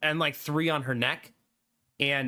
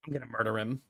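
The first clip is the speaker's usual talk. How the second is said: much lower and softer growled threat; low menacing dog voice; 8 semitones lower, 6 dB quieter, faster than usual. Pretend. Old dog Sadie threatening the boy dog, low menace. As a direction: murmur it like a sinister dog.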